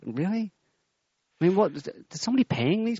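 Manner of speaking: a sharp, disbelieving question; incredulous. Real, not acted. Host scoffs at officials' gullibility, incredulous.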